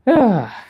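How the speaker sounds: a loud high "Ah" as the stretch bites; strained stretch groan. Real, not acted. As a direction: let the effort out in one sharp cry. Strain deep in hamstring stretch.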